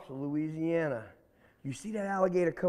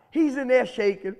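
ordinary delivery

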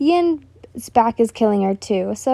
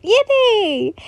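excited cheer; 11 semitones higher, 3 dB louder than usual. Real, not acted. Reader cheers before reading.

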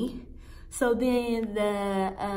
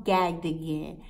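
ordinary talk

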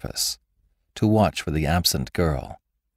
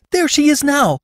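much higher and louder, an eager cry; cried out; 5 dB louder than usual. A narrator's reading. Rebecca thinking Agnes has come home, crying out eagerly. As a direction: call it out as she rushes to the door. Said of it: "she cried".

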